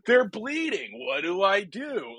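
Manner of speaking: high, slowing mock-panicked doctor. Co-host mocking a helpless doctor, high mock panic. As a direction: squeal it like a helpless intern.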